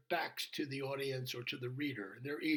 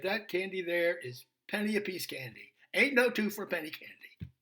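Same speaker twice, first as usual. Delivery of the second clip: surly waitress; 5 semitones higher, 7 dB louder than usual. Retold or imitated.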